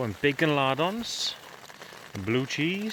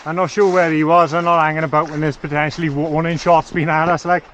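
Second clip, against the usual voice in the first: loud; 4 semitones higher, 10 dB louder than usual. Real, not acted. Hurrying away from possible warning shots.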